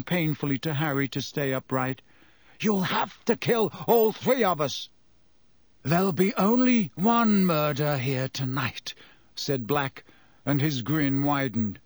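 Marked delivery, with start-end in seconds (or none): 2.6-4.9 defiant. A narrator's reading. 5.8-8.9 menacing. A narrator's reading.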